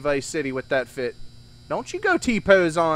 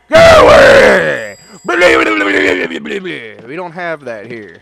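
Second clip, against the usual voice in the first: slow, very loud bellow; slow booming shooing; bellowing go away; 2 semitones lower, 11 dB louder, much slower than usual. Acted. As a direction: bellow them out. Chasing away Dune lady.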